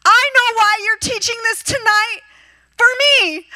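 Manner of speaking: high, loud, thrilled outburst; excited. Retold or imitated. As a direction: burst out like it all suddenly makes sense. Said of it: "She was so excited".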